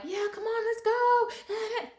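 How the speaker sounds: put-on eager old self. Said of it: "the old me would be like".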